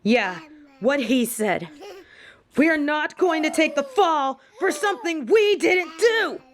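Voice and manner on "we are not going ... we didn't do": firm refusal. Acted. Refusing to take the fall.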